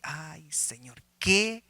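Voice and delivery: slow dread, starting under the breath; a hushed sigh, then slow dismayed wondering; retold alarm, drawn out. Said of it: "me asusté yo".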